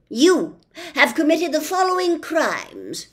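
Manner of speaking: loud, slowish officious policeman; pompous policeman. Acted. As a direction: lay down the law with bluster. Policeman lists crimes.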